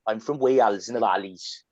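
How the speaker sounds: singsong Valleys Welsh accent. Shown as a demonstration. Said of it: "can you see how my voice changed there".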